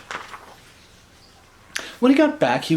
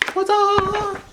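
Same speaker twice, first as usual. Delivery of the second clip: cheer. Real, not acted. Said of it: "celebrating the end".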